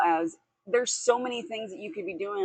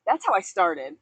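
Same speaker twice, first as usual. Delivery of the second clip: ordinary delivery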